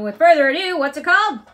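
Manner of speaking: high, loud eager announcing; loud, high showbiz intro; excited announcing. Real, not acted. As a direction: bring it in loud and high like a show host. Parent announcing the kid's movie.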